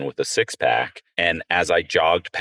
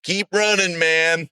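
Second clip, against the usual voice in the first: higher cheerful encouragement; guy at the gas station calling after him; friendly send-off; 9 semitones higher, slower than usual. Retold or imitated. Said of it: "he went".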